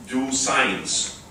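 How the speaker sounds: normal talking voice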